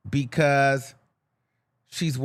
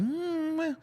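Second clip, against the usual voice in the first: skeptical hmm; 13 semitones higher, 4 dB quieter than usual. Retold or imitated. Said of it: "that's kind of where I was like".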